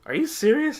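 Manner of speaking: very high-pitched retold disbelief; incredulous. Narrator reacting to the rat's name, acted incredulous. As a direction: shoot the pitch up in mock astonishment.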